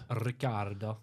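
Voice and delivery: put-on a light Italian accent on the name. Quiz player answering, slipping into a light Italian accent. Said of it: "I don't know why I did an Italian accent for that".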